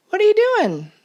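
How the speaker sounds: a bright little rabbit asking; squeaky rabbit. A narrator's reading. Rabbit's curious question.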